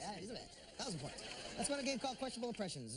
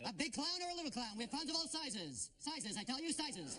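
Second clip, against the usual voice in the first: clown shop clerk; 3 semitones higher than usual. Acted. Clown shop clerk answering phone.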